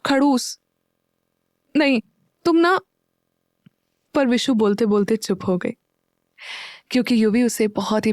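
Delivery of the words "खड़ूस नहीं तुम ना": put-on higher, teasing girl's voice; Vishu's playful voice. A narrator's reading. Girlfriend teasing her boyfriend, higher playful voice. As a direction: tease him, pitched up. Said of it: "Vishu बोली".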